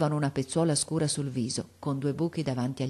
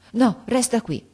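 high, louder urgent hold-back; frightened plea; 7 semitones higher, 5 dB louder than usual. Acted. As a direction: keep him close in alarm. Frightened grandmother holds boy.

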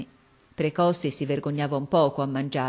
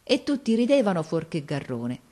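ordinary delivery, nothing marked

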